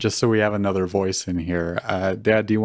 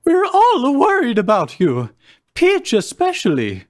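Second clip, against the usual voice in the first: pleading Mario voice; 12 semitones higher than usual. Acted. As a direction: beg your friend to come out.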